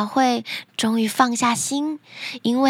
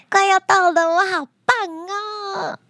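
put-on high, gleeful child voice; excited little girl; 8 semitones higher than usual. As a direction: squeal proudly, stretching the last words. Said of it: "她更觉得兴奋了".

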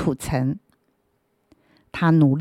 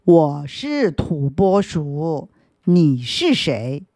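slow, lower groundhog asking back; a slow, low burrowing creature; 3 semitones lower, slower than usual. Acted. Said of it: "低沉的声音反问过来".

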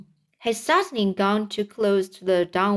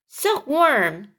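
higher-pitched mild yell; urgent yell; 5 semitones higher than usual. Acted. James calling the silkworm as his friend falls, mild yell. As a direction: call out sharply for the silkworm's help. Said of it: "yelled James".

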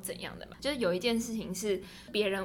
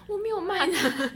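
higher and louder, laughing protest; flustered denial; 9 semitones higher, 7 dB louder, slower than usual. Retold or imitated. Retelling denying to the girl, laughing protest. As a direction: protest your innocence through a laugh.

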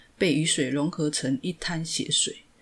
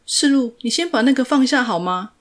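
higher, louder, pleading call; anxious shout; 7 semitones higher, 8 dB louder than usual. A narrator's reading. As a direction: beg him urgently to put it down. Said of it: "她也喊着". (着急地喊)

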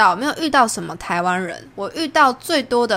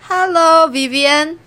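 much louder, higher cheerful greeting; 响亮招呼; 8 semitones higher, 6 dB louder, much slower than usual. Retold or imitated. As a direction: call out the wrong name happily. 同学大声叫错名字. (又高又响地大声招呼)

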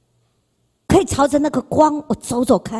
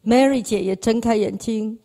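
ordinary delivery

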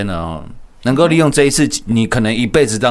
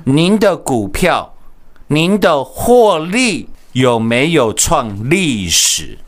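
slow hyped pitch; 3 semitones higher, much slower than usual. Acted. Slow drawn-out sales pitch.

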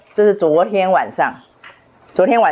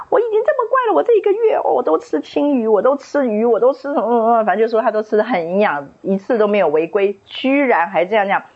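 high whiny retold complaint, pitched well up; mock angry complaint; 5 semitones higher than usual. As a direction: play the upset girl, high and aggrieved. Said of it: "很生气的说".

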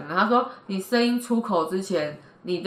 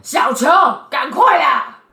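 小球，赶快啦 higher and louder, hurrying; urging impatient call; 8 semitones higher, 10 dB louder, slower than usual. Retold or imitated. Wife imitating husband rushing the child, loud. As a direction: call the kid to hurry up, leaning on 啦. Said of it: "那是在催促啊".

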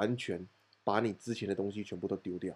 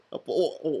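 ordinary talk

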